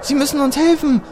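high-pitched urgent plea from a winded woman; panting woman's plea, high and strained; out of breath. A narrator's reading. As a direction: voice a woman begging for help. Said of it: "stöhnte die Frau völlig aus der Puste".

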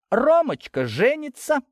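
slow, high-pitched mocking announcement; caustic. A narrator's reading. Girl taunting her cousin about his wedding, caustic. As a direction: draw out the news with spite. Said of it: "язвительно заявила она".

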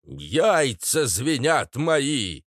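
very slow, high quaver; quavering parrot. A narrator's reading. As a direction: wobble it out as a rattled parrot. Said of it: "сообщил дрогнувшим голосом Паша". (дрогнувшим голосом, протяжно)

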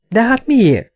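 high, plaintive why; high-pitched protest; protesting mother. A narrator's reading. Mother protests, voiced in story.

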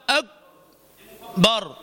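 "أكبر" with the K stopped dead, no air; K without hams. Shown as a demonstration. Teacher showing students the lost attribute, unaspirated K. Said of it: "أنت ضيعت صفة من الصفات".

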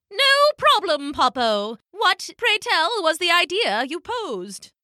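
high, prim elder penguin; high-pitched genteel character; Pippo. A narrator's reading. Pippo answers Poppo.

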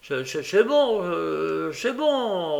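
high, stammering placation; put-on high voice, hesitant and appeasing; flustered soothing. A narrator's reading. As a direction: stammer while trying to calm her. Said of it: "en tâchant de calmer Nancy".

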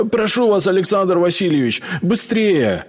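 high, urgent begging; pleading. A narrator's reading. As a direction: beg him to hurry. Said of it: "взмолился бывший императорский повар". (отчаянная мольба)

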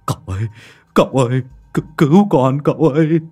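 high, loud, frantic begging; cling and beg for rescue; desperate plea. Acted. Lâm Sẹo clutching cậu Tẩy's leg begging for help, desperate plea. Terrified man begs cậu Tẩy.

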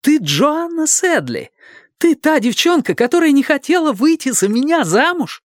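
he can't believe who she is; stunned young man. A narrator's reading. Dick's stunned recognition.

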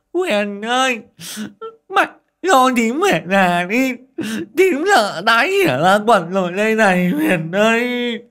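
high, loud tearful wail; frightened woman crying to the principal; sobbing plea. A narrator's reading. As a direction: blubber and beg to go home. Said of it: "mếu máo".

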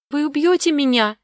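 put-on high, scared child's cry; frightened exclamation. A narrator's reading. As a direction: cry out in dread as a little girl. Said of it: "воскликнула испуганно Огнева".

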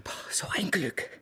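freudiges Flüstern; flüstere die Freude heraus; geflüstert. Acted. (Saccard flüstert erleichtert über sein Glück)

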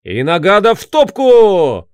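loud, slow rallying shout; battle cry. Acted. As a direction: roar it like a war cry. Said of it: "свой боевой клич".

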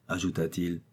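ordinary delivery

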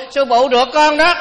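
mock tattling kid voice; child novice. Chú Điệu trả lời, mách tội. (giọng chú tiểu mách, cao và to)

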